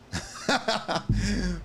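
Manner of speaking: bursting high laugh. Real, not acted. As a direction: crack up. Laughs at the line.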